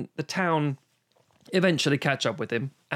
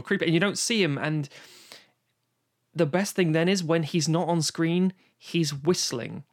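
ordinary talk

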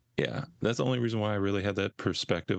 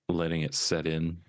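ordinary talk, nothing marked

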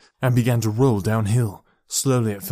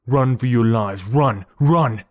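louder, insistent warning; urgent warning; 5 dB louder, slower than usual. A narrator's reading. Boy recalls a warning to flee, urgent call. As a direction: urge them to flee for their lives.

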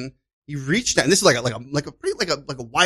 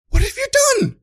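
much higher, bewildered imitation of the stranger; baffled stranger; 5 dB louder than usual. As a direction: play the confused man who can't believe it. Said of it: "he looked at me like".

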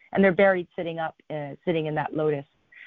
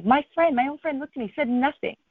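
disbelief in a much higher voice; incredulous; 9 semitones higher than usual. Retold or imitated. Storyteller recalling her own friend said nothing, incredulous. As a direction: pitch it high, baffled by the silence.

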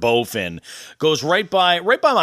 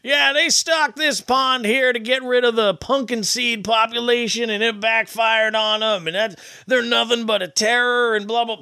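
higher-pitched, lightly voiced drunk local; eager, pitched-up angler chatter; drunk fishing regular; 9 semitones higher than usual. Host voices the drunk regular at his fishing spot, pitched up. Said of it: "He's like".

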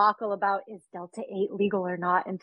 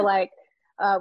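ordinary delivery

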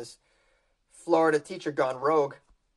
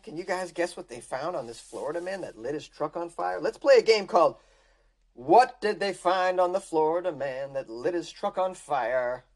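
normal talking voice

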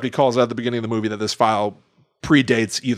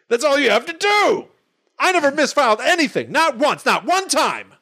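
much higher, pompous boast; high manic Cage voice; 13 semitones higher than usual. Retold or imitated. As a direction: strut it out, proud and high. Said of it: "And then he goes".

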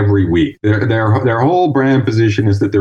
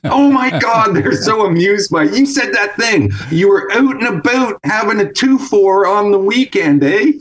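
much higher, hammed-up Canadian accent with a big "eh"; mock Canadian accent; 9 semitones higher than usual. Mocking how Americans react to Canadians, hammed-up Canadian accent. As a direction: ham up a cartoon Canadian. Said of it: "Canadian is like a wrench".

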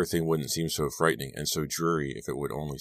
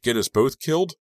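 much higher, loud furious scream; 9 semitones higher, 6 dB louder than usual. A narrator's reading. Girl screaming at the boy who grabbed her while driving, furious. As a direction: scream at him in terror and rage. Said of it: "I screamed".

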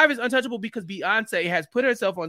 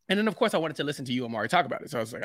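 usual speaking voice

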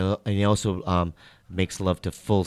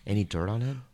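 ordinary talk, nothing marked

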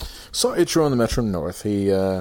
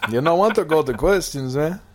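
higher mock barrio accent; Ichiro; 4 semitones higher than usual. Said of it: "you sound like you're from the barrio".

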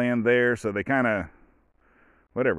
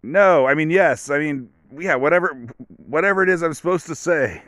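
flustered, higher and louder, stumbling over answers; a nervous yes-man scrambling for the right answer; mock flustered official caught off guard; confused official; 6 semitones higher, 5 dB louder than usual. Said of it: "were looking, like, confused".

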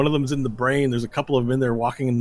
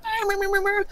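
high-pitched rapid babble; squeaky gibberish chatter; panicking little men. Acted. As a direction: jabber like tiny creatures in alarm. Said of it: "all the men inside are like".

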